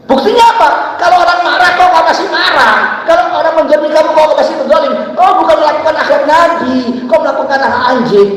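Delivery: high, loud scolding sermon; Maulana Jamshid's fiery rebuke. Retold or imitated. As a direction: rebuke the congregation like the teacher. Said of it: "Saya cuma menirukan Maulana Jamshid". (menirukan teguran keras Maulana Jamshid dengan suara tinggi)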